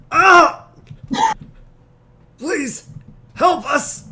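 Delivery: high, loud cry for help; desperate yell. Acted. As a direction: scream for help as he's hit. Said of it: "yells out".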